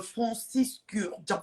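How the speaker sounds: Francis Kurdian spelled out slowly, syllable by syllable; sounding out the name. Shown as a demonstration. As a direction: streamer reading out the perfume maker's name from a viewer's message, slow and careful. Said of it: "Carole vient de m'écrire ça".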